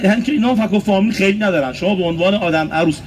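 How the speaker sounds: ordinary talk, nothing marked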